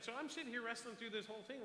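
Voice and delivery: ordinary delivery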